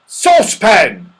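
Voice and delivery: loud, high, stretched-out yell. Acted. Mister What's-his-name spotting his friend, yelling. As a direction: bellow the name in amazement. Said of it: "he yelled".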